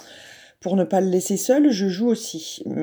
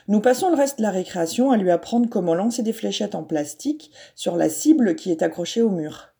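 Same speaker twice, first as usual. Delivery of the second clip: ordinary delivery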